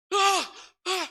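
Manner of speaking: loud, high gasp for air; sudden desperate intake of breath; gasping yell. Retold or imitated. Man waking without breath, gasping yell. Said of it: "harus teriak-teriak".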